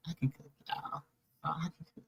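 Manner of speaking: soft whispered boast, like a secret. Pretend. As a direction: lean in and whisper it.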